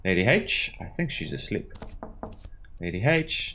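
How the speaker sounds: ordinary delivery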